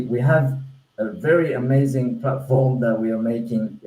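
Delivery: usual speaking voice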